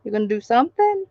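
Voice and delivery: ordinary delivery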